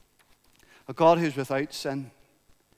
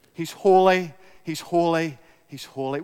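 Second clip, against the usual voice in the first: a solemn "he's holy" dying to a hush; fading repetition. Real, not acted. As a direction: let the threefold "holy" sink away.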